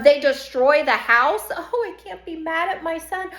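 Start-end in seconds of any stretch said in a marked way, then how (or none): 1.5-3.3 high, soft doting mother; mock indulgent mother. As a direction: coo it like a mother excusing her son. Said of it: "mother's excuse".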